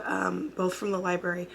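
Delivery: normal talking voice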